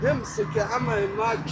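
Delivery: soft, gentle comforting; soft reassurance. Real, not acted. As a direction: reassure him quietly. Reassuring the sick man softly.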